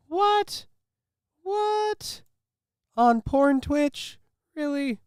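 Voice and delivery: squeaky falsetto disbelief; mock shock. Reading the content warning, mock-shocked falsetto. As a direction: gasp it out in a high falsetto.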